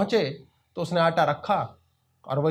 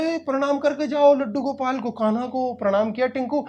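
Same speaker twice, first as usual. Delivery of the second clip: higher calling mother; mother calls Tinku to bow, higher call; 8 semitones higher than usual. Acted. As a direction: call the boy back before school.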